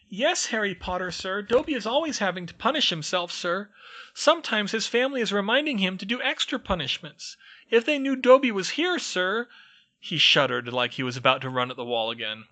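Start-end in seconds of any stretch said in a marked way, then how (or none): 0.1-9.5 very high, loud squeaky elf voice, urgent; a tiny servant pleading a warning; anxious house elf. Acted. House elf warns Harry, squeaky and urgent. Dobby's voice held.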